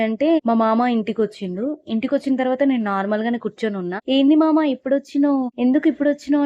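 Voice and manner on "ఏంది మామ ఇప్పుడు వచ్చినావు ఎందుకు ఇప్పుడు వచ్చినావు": higher, louder suspicious challenge; possessed niece challenging her uncle, higher and louder; confrontational. Retold or imitated. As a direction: demand to know why he came.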